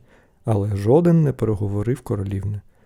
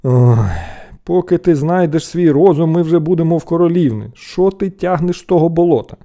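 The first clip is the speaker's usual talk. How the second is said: loud, higher, fed-up voice; scornful, raised voice; exasperated scolding; 5 semitones higher, 7 dB louder than usual. A narrator's reading. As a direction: groan at your hopeless brother. Brothers mock and scold.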